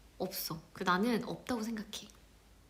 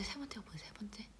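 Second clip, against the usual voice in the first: unvoiced muttered whisper; whisper to self; 20 dB quieter than usual. Real, not acted. Stuck on the test, a whisper to herself. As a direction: puzzle it out under the breath.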